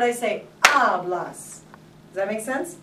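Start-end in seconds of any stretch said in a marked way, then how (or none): 0.6-1.6 HABLAS with a long, stressed AB. Shown as a demonstration. Teacher demonstrating the rule, first syllable of HABLAS stressed. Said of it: "what's the second to the last vowel or syllable".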